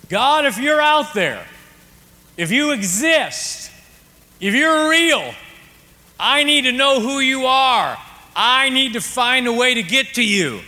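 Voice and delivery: high, loud cry out to the sky; lonely father crying out to God from a pier; desperate yell. Retold or imitated. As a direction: call out to God across the water. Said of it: "He yelled it out in the ocean".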